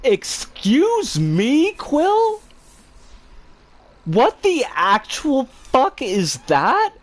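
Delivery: slow, high, stunned outrage; outraged disbelief. Real, not acted. Reading a chat message from Quill, slow, high, stunned outrage. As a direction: drag it out, can't believe what you just read.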